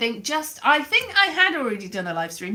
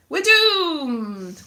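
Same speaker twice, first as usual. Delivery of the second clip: high, drawn-out mock wail; theatrical cry of doom; 5 semitones higher, 4 dB louder than usual. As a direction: wail it in comic despair, stretching DOOMED. Mock lament over Brexit bell.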